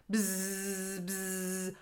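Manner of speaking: soft far buzzing; wasp buzz. Acted. Wasps chase the tiger, soft buzzing.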